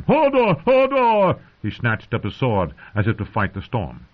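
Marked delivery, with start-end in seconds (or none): 0.0-1.4 two loud, high yells. A narrator's reading. Hodor fighting the storm, yelling. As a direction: yell it out in alarm, twice. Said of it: "Hodor yelled again".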